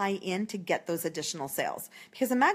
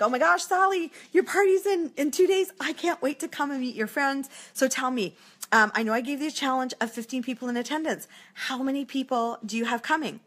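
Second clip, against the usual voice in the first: excited phone call; 5 semitones higher than usual. Retold or imitated. Consultant calling hostess Sally two days before her party, thrilled. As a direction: gush like you can't wait to see her. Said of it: "'Cause I'm now getting excited".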